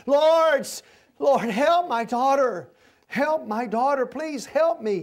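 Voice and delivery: high, begging cry for help; desperate pleading. Retold or imitated. Canaanite woman begging Jesus to heal her daughter, high pleading cry. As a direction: plead like a frantic mother for her child. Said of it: "she's over there going".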